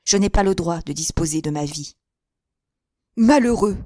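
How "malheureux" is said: a sharp, louder outcry; cried out. A narrator's reading. Father crying out at his son, alarmed and louder. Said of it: "s'écria monsieur de Boiscoran".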